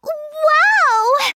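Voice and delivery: be blinded by the gold; dazzled wow. Acted.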